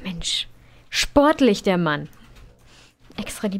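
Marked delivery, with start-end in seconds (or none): 0.0-0.5 whisper. Real, not acted. Muttered aside at sim.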